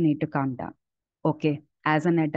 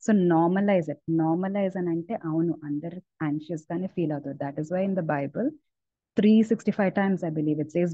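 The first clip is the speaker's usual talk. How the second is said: ordinary talk, nothing marked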